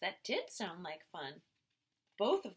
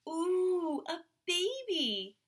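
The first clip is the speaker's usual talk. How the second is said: higher, louder, slow gushing; delighted girl; 8 semitones higher, 7 dB louder, slower than usual. A narrator's reading. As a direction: coo over the baby. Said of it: "Mothie said".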